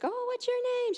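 high, singing greeting voice; cooing churchgoer. Retold or imitated. Churchgoers greeting a small child, high and cooing. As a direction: imitate a sweetly inquisitive churchgoer. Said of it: "people at church would ask".

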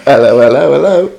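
loud British hello, climbing in pitch; hammed-up British accent; put-on British accent. Cohost teases host about accents, hammed-up British. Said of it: "if I had a British accent".

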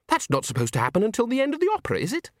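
high, quick, rising question; puzzled soprano. Acted. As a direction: ask it high and bewildered. Christine puzzled, high figure voice.

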